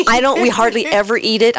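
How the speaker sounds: sheepish laugh running into the words; giggling through a confession. Real, not acted. Confessing bacon on air.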